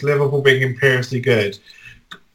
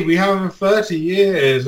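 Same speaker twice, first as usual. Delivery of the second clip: ordinary talk, nothing marked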